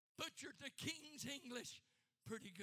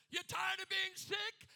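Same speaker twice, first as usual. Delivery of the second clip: ringing pulpit shout; shouted challenge; 6 semitones higher, 10 dB louder than usual. Real, not acted. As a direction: call out the question to the crowd. Shouted question to congregation.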